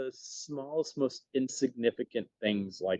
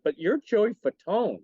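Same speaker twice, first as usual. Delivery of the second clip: starstruck disbelief; 8 semitones higher than usual. Retold or imitated. Starstruck retold reaction.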